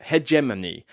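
hegemony with the stress on JEM; heh-JEM-uh-nee. Shown as a demonstration. Settling on the likely pronunciation, hegemony as heh-JEM-uh-nee. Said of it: "I think it's probably".

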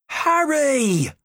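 high, loud call across the playground; shouted name. Acted. Classmate calling the daydreaming goalkeeper, loud shout. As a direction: yell his name to snap him out of it. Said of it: "They were all shouting at him".

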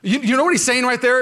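normal talking voice

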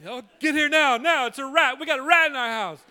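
high loud frantic pleading, a laugh at the end; loud high frantic plea about the rat. Retold or imitated. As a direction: panic over the phone. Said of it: "He said".

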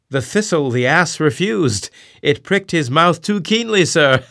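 high, loud, laughing jest; jesting exclamation. Acted. Stubb jokes to his captain over the wreck, loud and laughing. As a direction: Stubb cracks a bold joke, laughing.

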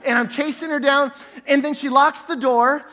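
usual speaking voice

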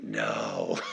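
very low, drawn-out refusal; Moses, dismayed. Acted. Moses learning that God picked him, mock dismay. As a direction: drop to a deep, quiet comic groan. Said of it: "Moses is like".

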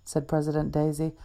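usual speaking voice

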